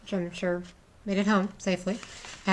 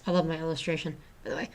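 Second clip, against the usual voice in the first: ordinary delivery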